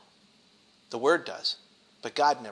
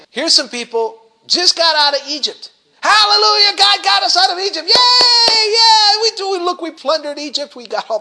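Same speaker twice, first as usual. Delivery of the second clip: shouting high with hammed-up joy; mock joyful celebration; 13 semitones higher, 9 dB louder than usual. Preacher voicing the Israelites cheering their escape from Egypt, hammed-up joyful shouting. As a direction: whoop it up like freed slaves.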